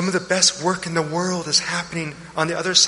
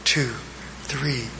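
slow, soft counting of breaths; 4 semitones lower, 5 dB quieter than usual. Shown as a demonstration. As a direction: count the breaths slowly and quietly. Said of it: "Try it again".

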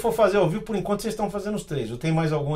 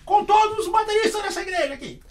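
lady pastor taking the microphone, high mock voice; imitating old lady pastor; 12 semitones higher than usual. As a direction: ham up a stern church lady. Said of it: "Mas é uma senhora, né, quadrangular".

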